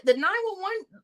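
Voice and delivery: normal talking voice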